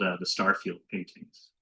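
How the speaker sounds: usual speaking voice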